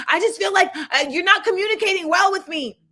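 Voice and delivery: frustrated date. Retold or imitated. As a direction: whine it like he's fed up. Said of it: "he just got so frustrated".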